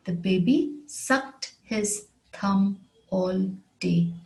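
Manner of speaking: slow, word by word with small gaps; measured teacher's repeat, pausing between words; slow model sentence. Shown as a demonstration. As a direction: spell it out slowly for learners, word after word. Example repeated slowly for learners.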